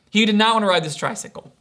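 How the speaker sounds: usual speaking voice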